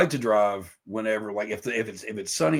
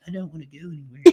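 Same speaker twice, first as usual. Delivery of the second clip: soft, higher little voice; mock reluctance; 6 semitones higher, 12 dB quieter, faster than usual. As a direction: shrink back in a small, reluctant voice. Voices own reluctance to leave.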